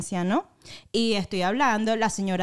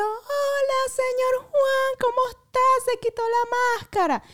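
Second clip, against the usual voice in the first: much higher, eager call down the aisle; excited greeting. Retold or imitated. Greeting the man she thinks is señor Juan, high and eager. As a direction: hail him with sudden joy from far off. Retold calling out greeting joyfully.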